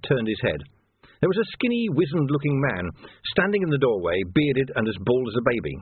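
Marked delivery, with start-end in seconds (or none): none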